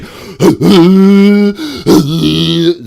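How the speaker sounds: high, loud vocal zoom of a truck; long high-pitched vehicle noise made by mouth; imitated speeding-off noise. Carrier speeds off down the street, imitated loud zoom.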